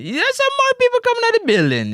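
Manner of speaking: very high, fast mock amazement; high-pitched exclamation. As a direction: squeal it out in a rush. Hyped repeat about crowd arriving.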